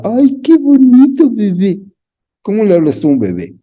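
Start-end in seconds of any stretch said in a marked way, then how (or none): 0.0-1.8 put-on high, loud cooing baby talk. Shown as a demonstration. Preacher showing how we coo to a baby.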